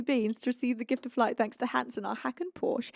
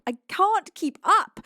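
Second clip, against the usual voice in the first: higher, louder, slow wail; overwhelmed, loud repeat; exasperated cry; 7 semitones higher, 7 dB louder, slower than usual. Real, not acted. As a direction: throw up your hands with a frantic cry. Gifts flooding in.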